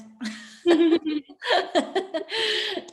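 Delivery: bright burst of laughter; high laugh. Real, not acted. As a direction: laugh out loud at yourself. Laughs at own joke.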